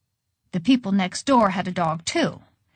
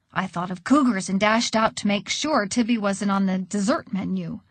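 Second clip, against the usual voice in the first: ordinary delivery, nothing marked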